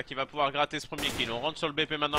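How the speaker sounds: ordinary delivery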